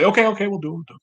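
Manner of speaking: high, hurried-sounding mock surrender; caving senator. Senator yielding to the benefactor's threat, high mock capitulation. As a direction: give in with a high put-on voice.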